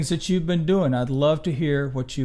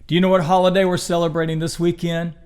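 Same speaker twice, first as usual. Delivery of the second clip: ordinary delivery, nothing marked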